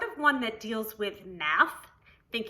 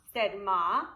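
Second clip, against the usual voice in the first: usual speaking voice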